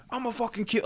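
high-pitched imitated threat; pissed off. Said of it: "like, pissed off".

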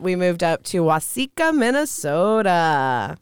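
stretched-out Minnesota vowels, long o in soda; Minnesota accent. Shown as a demonstration. Woman telling where her family moved, in Minnesota accent. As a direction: lean into a slow Minnesota accent. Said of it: "sometimes I get that little bit".

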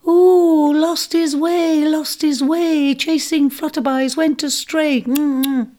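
high sing-song taunting imp voice, slowed; teasing imp. Acted. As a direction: chant it mockingly from the treetops, high and far. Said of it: "cause for amusement".

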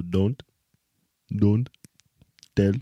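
Indian accent demo. Shown as a demonstration. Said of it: "Replace your T's with D's".

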